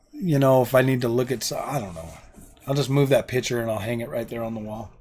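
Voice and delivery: ordinary talk, nothing marked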